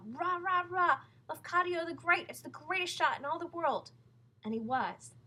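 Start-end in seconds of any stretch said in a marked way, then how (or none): 0.1-3.9 high, loud chanting cheer; crowd shouting. A narrator's reading. Audience shouting praise for Lafcadio. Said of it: "the people began to shout".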